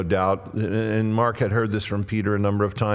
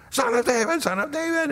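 very high, straining call; high-pitched plea for attention; crying out; faster than usual. Acted. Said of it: "he cries out the more".

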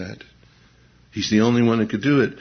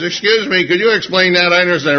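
mock nerdy student; 8 semitones higher, 6 dB louder, faster than usual. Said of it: "he says".

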